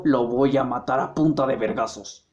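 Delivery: low, heavy threat; deep menacing voice. Acted. Second character vows revenge.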